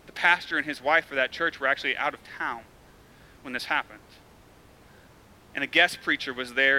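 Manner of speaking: sombre telling. Real, not acted. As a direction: speak it gently, weighed down by the tragedy. Sombre account of shooting.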